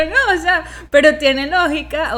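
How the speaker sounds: laughing reassurance. Retold or imitated.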